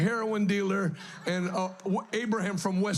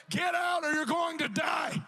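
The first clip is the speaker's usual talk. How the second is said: retold warning shout; 7 semitones higher, slower than usual. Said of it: "I literally was like".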